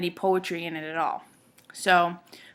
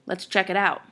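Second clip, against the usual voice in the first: ordinary delivery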